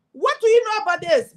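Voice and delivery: high, loud challenge; challenging quote. Retold or imitated. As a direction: throw it down like a doubting rival. Challenger on Senate floor.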